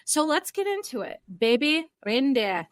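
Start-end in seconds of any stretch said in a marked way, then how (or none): 1.4-2.7 Scottish brogue. Pretend. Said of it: "my very awful Scottish brogue".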